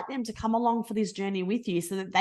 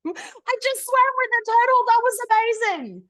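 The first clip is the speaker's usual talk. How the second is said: much higher, squealing with delight; excited little kid; 6 dB louder than usual. Retold or imitated. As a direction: gush like a kid who just swam with a turtle. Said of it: "like this excited little kid and go".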